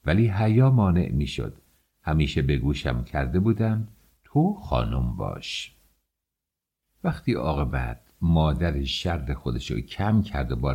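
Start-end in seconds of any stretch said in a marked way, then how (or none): 4.2-5.8 low, slow voice of a stern elder; a hint of a strict elder laying down the law; stern lesson. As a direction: lay down the rule slowly, low and firm. Elders drilling her to behave.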